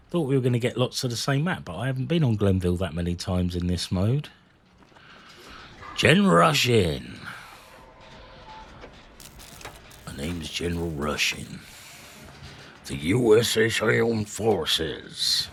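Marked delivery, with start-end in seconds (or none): 6.0-15.5 army general voice. Pretend. Self-introduced military general persona.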